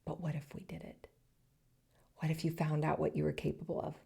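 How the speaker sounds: soft, gentle and unhurried, almost hushed; tender, hushed inner voice; voice of intuition. Acted. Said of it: "It's gonna always say things like".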